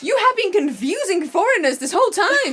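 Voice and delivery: loud, high, laughing mock accusation; mock outrage, laughing. Foreigner accusing British friend over tea naming, loud laughing outrage. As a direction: ham up the indignation through laughter.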